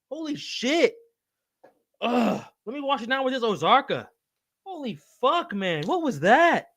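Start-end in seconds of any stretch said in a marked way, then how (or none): none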